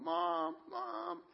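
small, high mock cry for Mom; scared child calling Mom. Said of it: "he's yelling for his mom".

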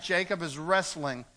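ordinary delivery

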